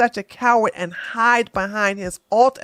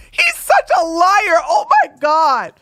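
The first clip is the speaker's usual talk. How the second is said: laughing shout; 8 semitones higher, 5 dB louder than usual. Real, not acted. Laughing at his lie.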